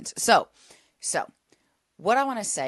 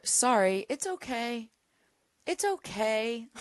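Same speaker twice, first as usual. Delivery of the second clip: dismissive brush-off; 6 semitones higher than usual. Retold or imitated. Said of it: "A lot of us are".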